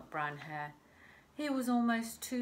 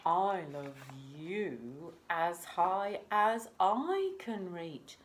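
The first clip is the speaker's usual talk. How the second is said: slow, louder, grand parent; big hare; 6 dB louder, slower than usual. Acted. Big hare answers little hare, slow and grand. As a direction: stretch it out like a gentle giant.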